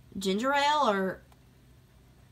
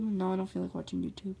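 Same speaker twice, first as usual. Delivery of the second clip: ordinary talk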